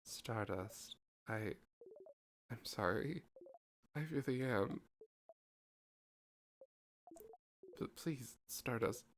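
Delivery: soft apology. Acted. Loop apologizing to Siffrin, soft and sincere. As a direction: apologize gently.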